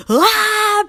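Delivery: a loud, high panicked "Ah"; panicked yelp. Retold or imitated.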